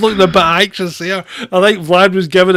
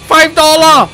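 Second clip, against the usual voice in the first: high, loud mock North Korean accent; poor North Korean accent; 11 semitones higher, 10 dB louder than usual. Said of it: "Your poor North Korean accent".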